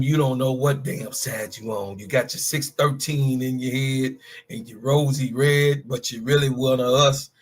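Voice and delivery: ordinary delivery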